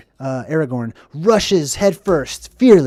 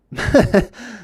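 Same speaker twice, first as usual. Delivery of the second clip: laughing; 7 semitones higher than usual. Real, not acted. Laughs after quote.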